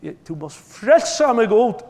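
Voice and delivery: slow, loud, high desperate plea; a sinner calling out to God, loud and slow; crying out to God. Acted. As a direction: beg God at the top of your voice. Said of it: "rópar Gud".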